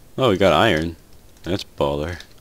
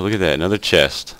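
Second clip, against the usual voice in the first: ordinary talk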